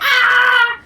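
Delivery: high, loud sung squeal; joyful scream. Retold or imitated. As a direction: let out a happy shriek.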